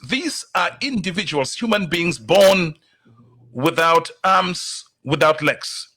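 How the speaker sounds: ordinary talk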